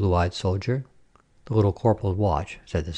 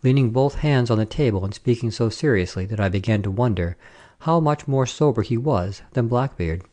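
usual speaking voice

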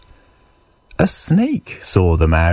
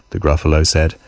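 ordinary delivery, nothing marked

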